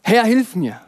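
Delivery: cried out loud and high, pleading; a drowning man's loud plea; desperate cry. Acted. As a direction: call for help as if going under. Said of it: "Petrus schreit".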